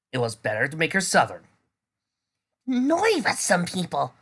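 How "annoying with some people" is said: grumbling. A narrator's reading.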